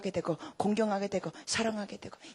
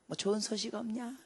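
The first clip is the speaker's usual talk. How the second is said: father asking; 9 dB quieter, slower than usual. Retold or imitated. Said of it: "전화하실 때마다 그래요".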